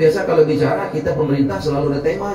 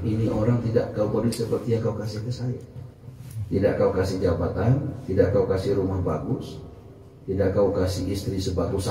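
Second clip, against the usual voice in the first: lower, softer inner plea to God; hushed prayer; 7 semitones lower, 8 dB quieter than usual. Retold or imitated. Man quietly asking God in a dark mosque, hushed. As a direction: murmur it like a private prayer in the dark.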